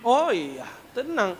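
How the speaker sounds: ordinary delivery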